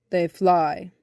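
low, loud, matter-of-fact reply; lightly voiced bumblebee. A narrator's reading. Bumblebee answers eager boy, low and firm.

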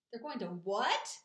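loud, drawn-out question leaping on WHAT; screamed disbelief. A narrator's reading. Pig hears the sheep's bad news, screamed in disbelief. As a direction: blurt it out in loud shock. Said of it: "screamed Wilbur".

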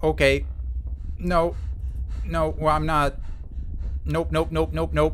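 loud panicked nopes; frantic and scared while running; being chased. Real, not acted. Continued fleeing in panic.

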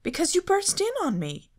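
put-on high, loud indignant young woman; Daphne indignant. A narrator's reading. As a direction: scold him for bursting in, pitched high. Daphne indignant, voiced by narrator.

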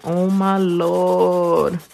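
drawn-out slow, stunned; slow mock-stunned gasp of words; stunned oh my Lord. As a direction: stretch it out in shock. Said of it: "I was like".

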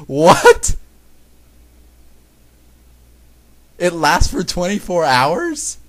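loud, high disbelief, drawn out; real high-pitched bafflement; incredulous. Real, not acted. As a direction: question it loudly, utterly baffled. Baffled by absurd story rule.

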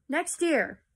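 high, loud shriek of a king. A narrator's reading. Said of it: "the king shrieked".

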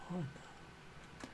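high hushed gasp of a whisper; soft squealing whisper. Real, not acted. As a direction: breathe it out in delight.

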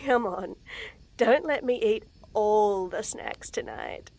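ordinary delivery, nothing marked

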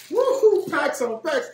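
high whooping cheer; excited whoop. Real, not acted. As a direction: whoop with joy. Cheering over packages.

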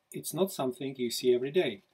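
lively picture-book read-aloud; storybook narration. A narrator's reading. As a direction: read it like to children at bedtime. Author reads picture book aloud.

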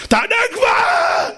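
very high, drawn-out scream; exasperated yelling. Acted. Angry man to his unruffled opponent, yelling. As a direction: scream it as if maddened by the calm. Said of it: "הוא צועק".

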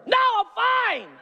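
high, loud, strained 'I'm fine'; mock-furious husband insisting he's fine; snapped denial. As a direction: bite back that you're fine. His snapped reply to wife.

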